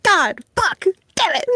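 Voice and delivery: high, retold outburst of rage without much volume; a hammed-up yell of fury, not really loud; mock furious yelling. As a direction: imitate a villain cursing in frustration. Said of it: "He was screaming really loud".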